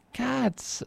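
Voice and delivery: high, eager merchant; eager Antonio. A narrator's reading. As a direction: sing out the word, amazed they don't know. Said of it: "said Antonio".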